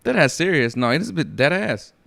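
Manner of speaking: ordinary talk, nothing marked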